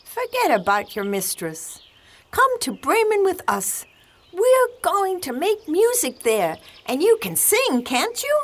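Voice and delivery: very high, eager donkey voice; exclaiming donkey. A narrator's reading. Donkey inviting the cat to make music, high-pitched exclaiming. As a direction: urge them along in a high donkey voice. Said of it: "the donkey exclaimed".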